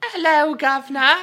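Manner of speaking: high, loud mock Cockney British greeting; cartoonish British accent, overdone on purpose; bad British accent. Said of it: "That's not even the right accent".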